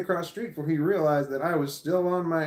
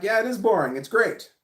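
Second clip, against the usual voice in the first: higher and louder, gleeful; bright, louder sarcastic cheer; cheerful mock reply; 7 semitones higher, 6 dB louder than usual. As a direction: happily own the boredom. Mock reply to imagined listener.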